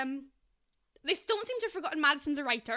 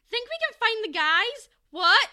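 high, loud ditzy squeal; Barbie voice; 8 semitones higher, 11 dB louder than usual. Retold or imitated. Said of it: "Barbie's like".

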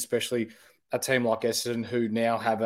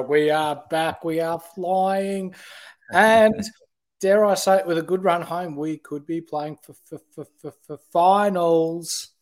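excited; 6 semitones higher, 5 dB louder than usual. Real, not acted. Cheering his team's return.

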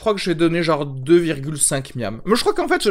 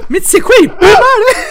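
put-on much higher, louder, laughing Southern French accent; strong Southern accent; 9 dB louder than usual. Mocking Arnaud as an old man, thick Southern French accent through laughter. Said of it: "il aura un accent encore plus prononcé".

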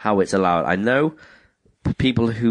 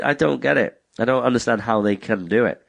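ordinary talk, nothing marked

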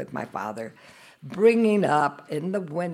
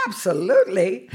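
eager yes; 5 semitones higher, 10 dB louder than usual. Retold or imitated. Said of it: "And I said".

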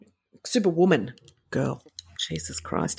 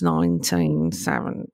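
pirate voice; 14 semitones lower, slower than usual. Pretend. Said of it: "I'd always turn into a pirate".

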